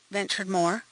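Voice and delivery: ordinary delivery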